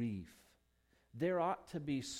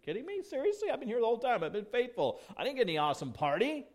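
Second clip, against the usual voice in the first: much higher, louder, outraged complaint; indignant older brother; 11 semitones higher, 7 dB louder, much faster than usual. Retold or imitated. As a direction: whine it like the wronged older brother. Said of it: "older brother who's really angry".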